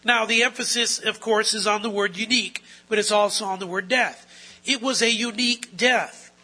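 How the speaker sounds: rising sermon fervour; preaching emphasis. Acted. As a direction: drive home the point to the church.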